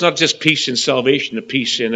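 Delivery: usual speaking voice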